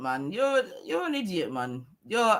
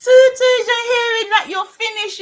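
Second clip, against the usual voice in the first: high, loud, crowing repeat; loud exclamation; 10 semitones higher, 8 dB louder than usual. Real, not acted. Same line repeated, exclaiming.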